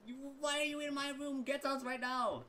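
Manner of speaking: put-on a startled young woman throwing out an intruder; shrill demand. Woman tells the soldier in her room to get out, high and loud.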